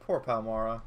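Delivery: normal talking voice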